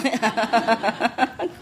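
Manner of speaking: quick rippling laugh. Real, not acted. Master amused at being scolded, real laugh. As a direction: break into a run of chuckles.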